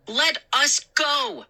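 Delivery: high, slow and firm; demanding. Acted. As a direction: lay down the demand, slowly. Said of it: "I demanded".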